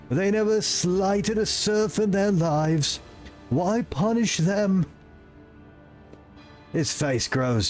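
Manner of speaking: high, slow pious lament; grieving confessor. Acted. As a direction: mourn the dead then vow vengeance. Voices Confessor Aldebert.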